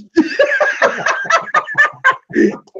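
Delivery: high-pitched staccato cackle; big helpless high laugh. Real, not acted. Laughing at his retold comeback.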